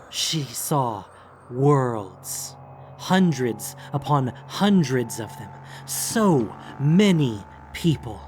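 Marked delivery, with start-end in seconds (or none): none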